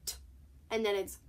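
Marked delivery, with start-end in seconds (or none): 0.0-0.2 "T" as a bare t tap, no vowel; just the t sound for "T"; individual letter sound. Shown as a demonstration. Teacher showing T on its own, bare letter sound. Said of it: "individually it's".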